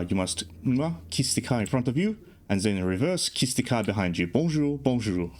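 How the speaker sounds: mock a French tour guide with nasal French vowels; horrible French accent. Tour guide explaining Parisian parking, in a mock French accent. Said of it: "excuse my horrible French accent there".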